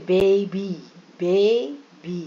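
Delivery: बेबी with the first vowel drawn out; long A sound. Shown as a demonstration. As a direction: stretch the ए in बेबी, teacher-style. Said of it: "लंबा खींचे".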